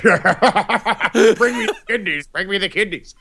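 high, slow, laughing demand; hammed-up high voice begging for food; crazed greedy diner. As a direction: drool over it in a high voice. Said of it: "you eat like Ray Liotta from Hannibal".

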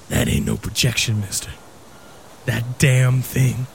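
a hint of a haunted warning; ominous old shopkeeper. Ominous shopkeeper warns narrator.

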